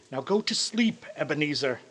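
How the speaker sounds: quiet, settling himself to sleep; soft self-talk. Acted. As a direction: murmur it to yourself. Scrooge tells himself to sleep.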